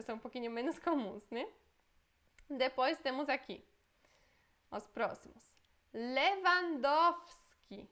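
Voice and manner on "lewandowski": slow, higher, louder Lewandowski with w as v; Polish pronunciation. Shown as a demonstration. Teacher presenting the footballer's name, slow and emphatic. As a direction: draw out Lewandowski like a demo. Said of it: "Lewandowski é um sobrenome polonês".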